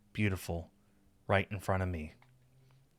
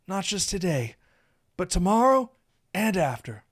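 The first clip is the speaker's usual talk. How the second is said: higher-pitched, emotional love confession; confessing Saphir; 9 semitones higher than usual. A narrator's reading. Saphir confessing his feelings to his new lover, emotional. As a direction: admit your feelings openly.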